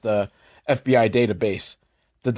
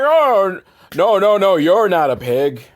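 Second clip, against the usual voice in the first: loud, higher panicked denial; flustered backpedaling; 6 semitones higher, 5 dB louder than usual. Acted. Dolph to the offended teacher, flustered. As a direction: take it back in a hurry.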